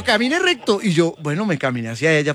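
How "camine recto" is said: orden más aguda y baja en volumen; doctora imitada, más suave. Retold or imitated. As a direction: imita a la doctora con calma. (el invitado imita la orden de la doctora, más aguda)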